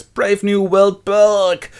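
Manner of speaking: high, loud, drawn-out retching cry; disgusted outcry. Acted. Savage cannot cope with identical twins, disgusted outcry. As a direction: stretch it out in mock nausea.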